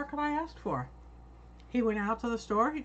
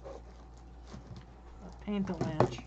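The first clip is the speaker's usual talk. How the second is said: whispered; 4 semitones lower, 7 dB quieter, much slower than usual. Real, not acted. Whispered aside after dropping paint.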